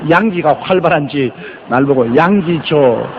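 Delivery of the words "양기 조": 양기 조 as YANG-gi with a long jo; American mispronunciation. Retold or imitated. Pastor imitating Americans saying his name, drawn-out 양기 조. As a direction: stretch the end of 양기 조. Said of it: "미국에 가면 대개 내 이름을 양기 조라".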